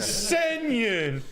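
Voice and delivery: high, laughing sing-song; laughing high mock voice. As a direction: squeal it through laughter. Laughing repeat.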